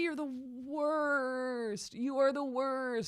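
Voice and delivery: slow, high, stretching out "worst"; mock drawn-out scolding. Voicing commenters to the restaurant guy, slow mock scolding. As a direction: drag out the insult like a chanting crowd.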